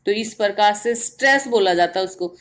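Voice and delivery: ordinary delivery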